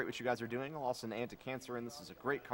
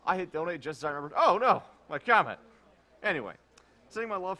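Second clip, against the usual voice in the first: mock dismay; 6 semitones higher, 11 dB louder than usual. Reading a donor's panicked comment.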